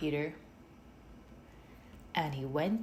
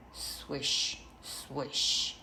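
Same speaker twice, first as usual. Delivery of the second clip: whispered swish; 4 semitones lower than usual. A narrator's reading. Leaves landing on the roof, whispered swish. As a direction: breathe out the swish like leaves falling. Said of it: "The leaves fall on the roof".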